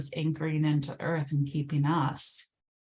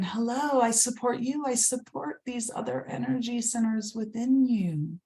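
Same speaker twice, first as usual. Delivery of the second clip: much higher, bright greeting as the earth; voice of Mother Earth; 7 semitones higher than usual. Pretend. Mother Earth greeting the energy centers, much higher voice. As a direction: greet your body as the supporting earth. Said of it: "really saying".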